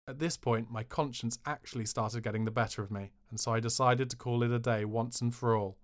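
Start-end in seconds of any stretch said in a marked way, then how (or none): none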